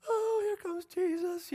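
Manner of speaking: high, slow, light Martha voice; a playful high take on eager Martha; voicing Martha. Martha spotting Jesus arriving, high and slow. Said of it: "And Martha's like".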